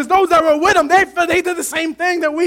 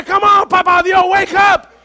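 high, loud pleading call; mock urgent shout; 5 semitones higher, 5 dB louder than usual. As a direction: shake him awake with your voice. Disciples waking Jesus.

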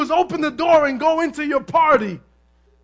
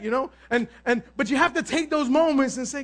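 ordinary talk, nothing marked

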